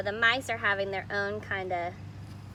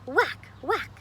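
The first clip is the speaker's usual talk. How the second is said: put-on lively read-aloud voice for children; storybook reading. A narrator's reading. Teacher reading a picture book to kids, animated. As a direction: read the story to the class with expression.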